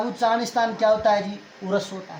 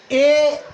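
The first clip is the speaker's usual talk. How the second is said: ए called out higher and louder, held; stressed vowel; 4 semitones higher, 5 dB louder than usual. Shown as a demonstration. Teacher breaks the sutra apart for students, loud stressed ए. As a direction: punch the ए to split the word. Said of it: "संधि विच्छेद करो इसका".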